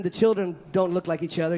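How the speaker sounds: usual speaking voice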